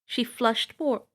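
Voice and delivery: ordinary delivery, nothing marked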